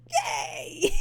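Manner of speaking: high breathless yay through laughter; laughing cheer. Real, not acted. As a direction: cheer it while giggling. Cheering the kiss.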